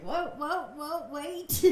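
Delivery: high-pitched retold panic; mock alarm. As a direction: act out stopping someone in a hurry. Retold alarm as mom undresses.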